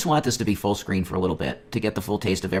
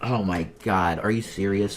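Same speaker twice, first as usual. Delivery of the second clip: ordinary talk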